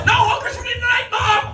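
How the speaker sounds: put-on high teenage shout to mom; shouted retort. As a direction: yell back at mom.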